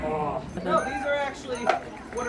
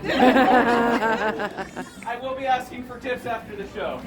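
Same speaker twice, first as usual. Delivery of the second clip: laughing; 4 dB louder, much slower than usual. Real, not acted. Joke about tips.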